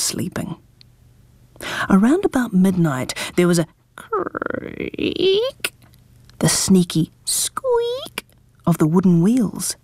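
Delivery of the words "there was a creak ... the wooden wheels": slow, drawn-out creepy telling; spooky slow narration. A narrator's reading. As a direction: stretch it out eerily. Spooky midnight sounds.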